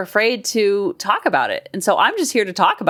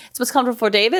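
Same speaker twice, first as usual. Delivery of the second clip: ordinary talk, nothing marked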